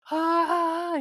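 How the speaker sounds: high, drawn-out mock scream; imitated scream, not full force. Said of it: "He's screaming".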